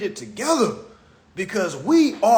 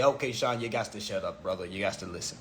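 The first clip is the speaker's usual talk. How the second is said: low, much softer aside; retold admonishment; 12 semitones lower, 11 dB quieter, faster than usual. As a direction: drop low and quiet, as if recounting a telling-off. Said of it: "I had to say".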